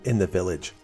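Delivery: ordinary delivery, nothing marked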